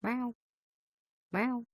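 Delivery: high little meows called to a cat. Pretend. Streamer calls her pet with high meows. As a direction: coax the kitty with meows. Calling to the kitty.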